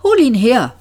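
put-on old man suddenly commanding; very firm tone. A narrator's reading. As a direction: order it with authority. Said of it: "einen sehr bestimmten Ton annehmen".

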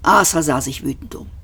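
ordinary delivery, nothing marked

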